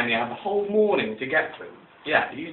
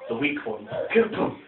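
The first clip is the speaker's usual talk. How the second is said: ordinary delivery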